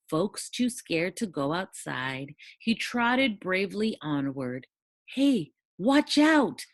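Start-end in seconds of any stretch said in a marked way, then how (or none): 5.0-6.6 loud, high, slow shout of warning; warning cry. Acted. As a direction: call out the danger loudly. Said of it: "the people cried".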